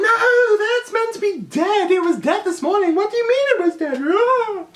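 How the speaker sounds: very high, loud, aghast protest; shocked shelter lady. Retold or imitated. A shelter worker protesting the dog was dead, high and shrill. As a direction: squawk it in disbelief.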